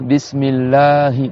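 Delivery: Bismillahi with a stretched a; prolonged a. Shown as a demonstration. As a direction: draw out the a in Bismillahi. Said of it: "on prolonge bien le A".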